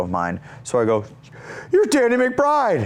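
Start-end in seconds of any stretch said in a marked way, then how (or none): 1.7-2.8 high, slow starstruck gush. Retold or imitated. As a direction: gush it like a fan meeting his hero. Starstruck meeting his hero.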